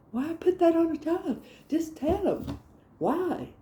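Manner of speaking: high, pleading exasperation; exasperated complaint. Acted. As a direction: plead as if it were obvious. Mock complaint about parents' warnings.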